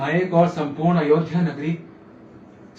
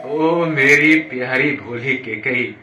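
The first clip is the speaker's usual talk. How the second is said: slow, lower, drawn-out endearment; fond coaxing; 5 semitones lower, 3 dB louder, slower than usual. Acted. King coaxing his sweet, naive queen, fond coaxing. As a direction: stretch each word of endearment.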